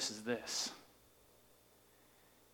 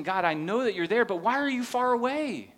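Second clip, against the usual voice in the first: higher, louder desperate plea to God; voicing David's plea, higher and louder; pleading cry; 7 semitones higher, 7 dB louder than usual. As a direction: beg God to show himself. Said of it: "he's basically saying".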